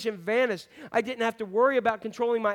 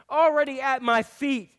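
louder, pleading prayer voiced at full force; impassioned preaching; 3 semitones higher, 5 dB louder than usual. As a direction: cry it out like a broken man at the Lord's feet. Preacher voices desperate prayer, rising.

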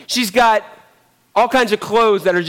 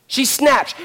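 normal talking voice

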